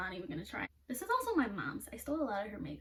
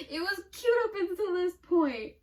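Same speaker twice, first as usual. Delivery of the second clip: half laughing, half whining; giggling in dismay; 8 semitones higher, 8 dB louder than usual. Real, not acted. Laughing at the ruined look.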